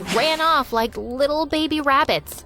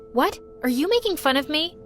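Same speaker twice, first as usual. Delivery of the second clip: usual speaking voice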